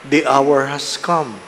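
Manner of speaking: loud, solemn scripture reading; Gospel proclamation. Acted. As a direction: proclaim it to the whole church.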